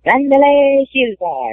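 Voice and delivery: slow, high, drawn-out ring announcer call; hammed-up announcer drawing out the name; announcer's accented call. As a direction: boom the name like a fight announcer. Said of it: "it just sounds so funny with the accent".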